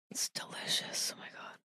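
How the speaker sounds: breathy whisper after a sip. Real, not acted. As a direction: whisper it, savoring the taste.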